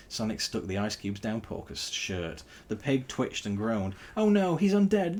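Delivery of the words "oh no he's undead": very high, loud, panicked shriek. A narrator's reading. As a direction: shriek in terror. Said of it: "shrieked Tails".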